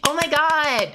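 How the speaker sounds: high-pitched imitation of giddy women; mock giddy. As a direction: squeal it in mock delight. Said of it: "get all giddy and they're like".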